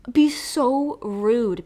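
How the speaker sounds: ordinary delivery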